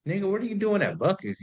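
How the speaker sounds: high, fast, incredulous question; surprised call-out. Retold or imitated. Texting her after seeing her post, surprised and high.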